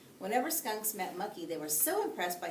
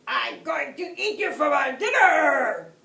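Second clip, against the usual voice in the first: loud, high, shaking yell; shaking with anger; 7 semitones higher, 15 dB louder, slower than usual. A narrator's reading. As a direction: yell it with rage trembling. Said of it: "yelled the wolf, his voice shaking with anger".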